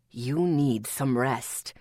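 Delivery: deep, slow man's voice; low insistent voice. A narrator's reading. Atticus insisting.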